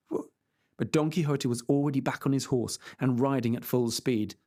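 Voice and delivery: plain reading voice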